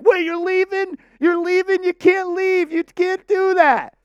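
high squeaky mocking whine; whiny high mock voice. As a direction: mimic panicked coworkers in a high squeak. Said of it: "And everyone's like".

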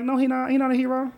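high, emphatic no; indignant denial. Retold or imitated. Answering people online, high and indignant. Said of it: "I'm like".

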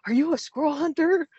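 high, loud, breathless question; retold out of breath. Hunter asking a stranger, retold breathless and high. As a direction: gasp it out after running. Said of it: "I'm out of breath".

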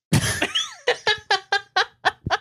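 high-pitched giggling fit; high giggle. Real, not acted. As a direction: break into a high squealing giggle. Laughs at own fake author note.

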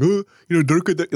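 put-on hacky Indian-accent parody; caricature Indian accent. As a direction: do a clumsy, played-out Indian accent. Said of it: "like, the Indian accent".

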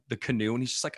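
normal talking voice

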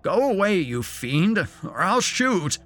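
loud high threat; threaten, shouting high; threatening old man. A narrator's reading.

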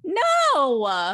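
loud, high, drawn-out protest; dramatic dismay. Acted. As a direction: wail it in exaggerated disbelief. Listener reacts to bad news.

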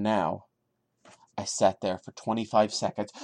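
low, measured reading of a creepy tale; story narration. A narrator's reading. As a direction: read it like a spooky story, holding back.

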